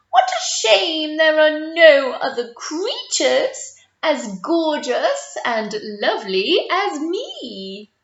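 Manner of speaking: high, slow, loud posh diva voice; a high, drawn-out show-off voice; vain angelfish. Acted. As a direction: preen like a vain beauty, darling. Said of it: "that's why she talks like that".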